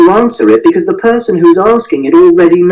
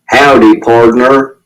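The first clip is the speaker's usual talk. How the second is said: lightly put-on cowboy drawl, lower; a low jokey mock-Western hello; joking cowboy greeting; 4 semitones lower, slower than usual. A narrator's reading. Father greets his son with his usual joke, mock cowboy. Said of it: "which is a joke he does".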